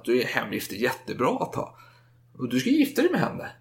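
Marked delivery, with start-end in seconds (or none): 2.4-3.5 mother's voice pitched way up, commanding; lightly mocking mother voice; high mock mother. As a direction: play the bossy mother, high.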